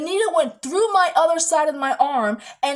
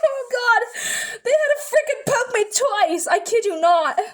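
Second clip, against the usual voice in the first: laugh-crying; 9 semitones higher than usual. Real, not acted. Telling viewers they poked her twice, real laugh-crying. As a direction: laugh through the pain of remembering it. Laughing while recalling painful poke.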